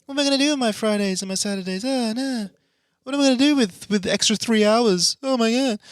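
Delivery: high, whiny mock panic; mock despairing fan. Mocking a listener who thought the show died. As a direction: ham up a fan's despair, pitched high. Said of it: "You went".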